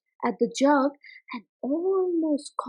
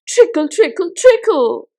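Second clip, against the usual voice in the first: trickle, trickle; 3 semitones higher, 9 dB louder than usual. A narrator's reading. Narrator dramatizes pouring treacle.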